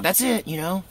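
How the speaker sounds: high, wounded self-talk; resigned inner voice. Retold or imitated. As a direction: think it aloud, hurt but accepting. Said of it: "so I thought".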